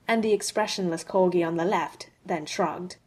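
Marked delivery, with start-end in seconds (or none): none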